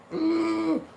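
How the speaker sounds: put-on high, breathy kid's ugh; disgusted ugh. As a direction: groan in disgust like a kid. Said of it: "looking at me like".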